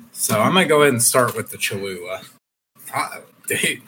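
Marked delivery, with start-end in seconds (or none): 2.9-3.8 straining at a stuck jar. Real, not acted. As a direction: talk while twisting a tight lid. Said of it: "I'm saying".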